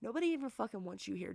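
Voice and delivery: usual speaking voice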